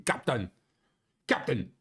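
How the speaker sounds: calling out Captain in a mock Scottish brogue; hammed-up Scottish engineer accent. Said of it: "your stupid Scottish accent".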